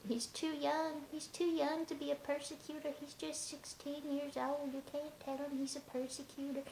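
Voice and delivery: high, softened mock-protest voice; fussing people mimicked. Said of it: "which some people get, like".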